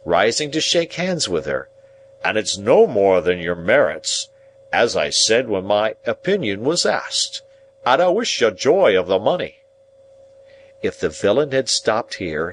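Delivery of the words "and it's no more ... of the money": grand, self-important congratulation; unctuous, stately well-wishing; pompous Pumblechook. Acted. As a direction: bestow praise as if responsible. Narrator voices Pumblechook congratulating.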